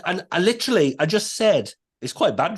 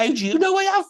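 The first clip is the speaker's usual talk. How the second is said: high, baffled question; retold puzzled disbelief; incredulous; 11 semitones higher, faster than usual. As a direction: ask it as if caught off guard. Retold baffled inner reply.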